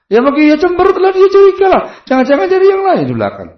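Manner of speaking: high, loud, fast jealous wife voice; shrill-free high squeal of a wife imagining an affair; suspicious wife. Retold or imitated. Preacher voicing a wife who suspects her husband, high and loud.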